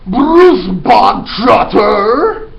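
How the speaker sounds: huge high drawn-out bellow; barked shout. Acted. Headmistress bellowing a boy's name at assembly. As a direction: bark the name across the hall. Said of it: "the Trunchbull barked suddenly".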